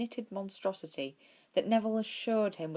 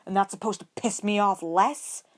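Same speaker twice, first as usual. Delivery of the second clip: louder, sharp angry bark; barked retort; 4 semitones higher, 8 dB louder than usual. A narrator's reading. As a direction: snap it back, fed up. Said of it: "Ed barked".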